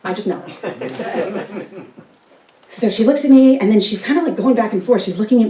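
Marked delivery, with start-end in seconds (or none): 0.5-1.8 quiet laugh. Real, not acted. Laughing at own secret.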